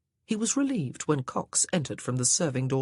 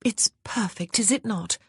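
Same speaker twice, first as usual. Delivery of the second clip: put-on higher, lilting young lady; higher ladylike voice; wife's voice; 5 semitones higher than usual. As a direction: sweetly suggest the wine. Wife character speaking.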